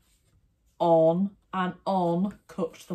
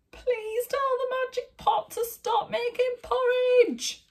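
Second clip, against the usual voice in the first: high, slow desperate plea; shouted plea. A narrator's reading. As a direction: beg her daughter to stop it, higher. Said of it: "shouted her mother".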